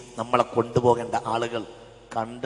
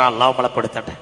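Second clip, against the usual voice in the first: normal talking voice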